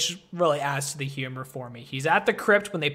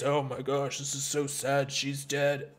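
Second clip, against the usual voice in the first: mock serious; 3 semitones lower, 7 dB quieter than usual. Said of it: "acts all serious".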